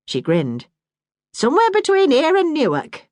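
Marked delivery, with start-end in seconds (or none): 1.3-3.1 lightly raised voice of a plain-spoken mother; a hint of a brisk northern mum; Bessie, motherly Yorkshire woman. Bessie reminisces.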